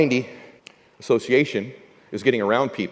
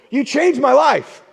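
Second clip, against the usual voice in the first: starstruck; 12 semitones higher, 4 dB louder, much slower than usual. Retold or imitated. Fan telling hero he changed life.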